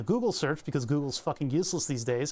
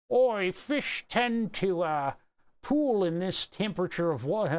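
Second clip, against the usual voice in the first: reading the line in a mock accent; drawling a jokey put-on accent; 3 semitones higher, slower than usual. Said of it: "Oh, my, lost my accent".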